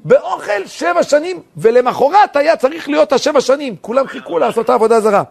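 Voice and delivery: high, loud preacher's delivery; impassioned preaching. Real, not acted. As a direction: ring it out with full force.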